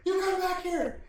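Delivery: high, loud yell, laughing; mock yell. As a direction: shout after the drone, hammed up. [name] yells at fleeing drone.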